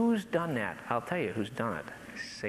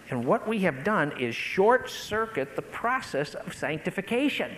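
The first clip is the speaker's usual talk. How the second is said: ordinary talk, nothing marked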